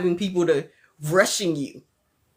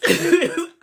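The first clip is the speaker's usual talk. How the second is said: loud high laugh burst; 10 semitones higher, 5 dB louder than usual. Real, not acted.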